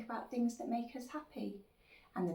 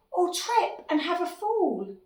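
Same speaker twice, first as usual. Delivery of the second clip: high, louder fretting; worried little dinosaur; 9 semitones higher, 13 dB louder, slower than usual. Acted. Little dinosaur imagining a fall, acted worry. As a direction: carry the worry on, pitched up.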